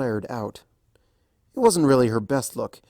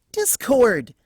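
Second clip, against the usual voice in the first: higher, louder protest; exasperated outburst; 12 semitones higher, 6 dB louder than usual. A narrator's reading. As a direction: cut him off sharply.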